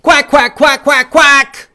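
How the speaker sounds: rapid volley of loud, high quacks; mad duck. Shown as a demonstration. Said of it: "Can you quack with me?".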